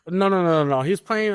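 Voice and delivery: ordinary talk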